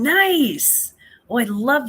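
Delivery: high, loud delighted exclaim; light up with delight; excited. Real, not acted.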